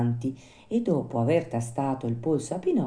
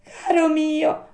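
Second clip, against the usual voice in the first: very high, slow little voice; consoling marmot; 5 dB louder, slower than usual. A narrator's reading. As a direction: comfort gently in a tiny marmot voice. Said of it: "replicò la marmottina per consolarlo".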